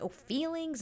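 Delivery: high-pitched mock-incredulous echo; bickering retort. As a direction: throw it back as a high bickering question. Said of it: "they start kinda, like, bickering".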